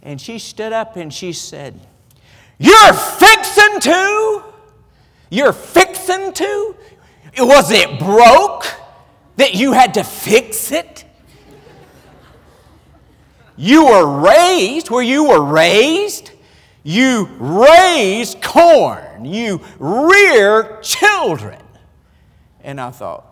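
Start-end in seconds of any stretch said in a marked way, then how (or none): 2.6-6.8 shrill, slow, loud mock of a stern teacher. Strict teacher mocks his phrase. 7.4-21.6 loud, slow, higher lecturing teacher voice; scolding teacher. Retold or imitated. Teacher lectures on correct usage.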